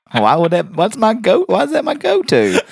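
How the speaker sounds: high-pitched, loud, incredulous self-reproach; flustered protest. Real, not acted. Host protesting to co-host about a crude word he blurted, high flustered outburst. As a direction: squeal in disbelief at your own slip.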